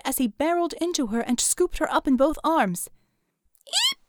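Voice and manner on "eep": squeaky startled yelp; high yelp. A narrator's reading. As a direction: let out a tiny shriek. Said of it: "she yelped".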